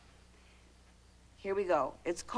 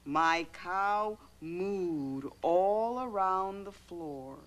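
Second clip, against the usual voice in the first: slow, stretched-out story reading; slow storytelling; 2 semitones lower, much slower than usual. Acted. Mother reads about the cow, slow and drawn out. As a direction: draw the words out, dropping away.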